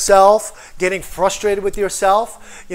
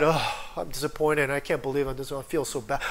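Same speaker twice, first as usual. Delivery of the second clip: low, soft self-pitying moan; mock dejection; 6 semitones lower, 8 dB quieter, faster than usual. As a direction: mope quietly, sinking under acted disappointment. Acting a self-pitying, disappointed believer.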